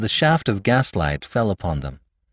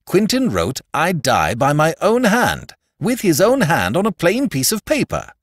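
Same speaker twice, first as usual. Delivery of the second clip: high, exasperated insistence; aggravated; 9 semitones higher, 3 dB louder than usual. A narrator's reading. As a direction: push the point in frustration. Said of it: "said the aggravated Flambeau".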